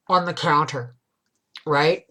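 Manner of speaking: ordinary talk, nothing marked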